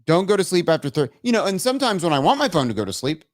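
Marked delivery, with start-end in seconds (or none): none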